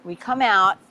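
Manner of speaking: ordinary delivery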